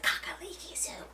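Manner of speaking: hushed, reverent whisper of the soup name; awed whisper. Retold or imitated. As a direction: breathe "Kakaliki soup" like a sacred word. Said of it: "And immediately I'm like".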